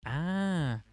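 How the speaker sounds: drawn-out, high "ah" swelling up; startled "ah". Real, not acted. Player reacting to the game's ending, drawn-out "ah". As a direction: let out a long rising cry of realization.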